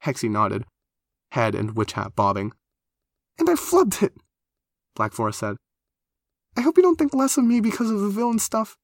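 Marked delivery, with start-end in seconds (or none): 3.4-4.2 very high-pitched wail of self-reproach; high, overwrought character voice; mortified lament. Acted. Lamenting her botched greeting. 6.6-8.8 very high, pleading character voice; worried, high-pitched appeal. Acted.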